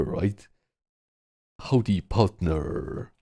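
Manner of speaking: put-on slow, drawn-out American cowboy accent; mock American cowboy accent. Streamer hamming up an American cowboy accent for chat. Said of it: "Let American accents live".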